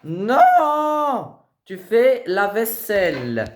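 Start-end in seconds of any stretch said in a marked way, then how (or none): 0.0-1.3 high, loud bratty no; teen refusing to do the dishes; shouted refusal. Acted. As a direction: yell no at your mother.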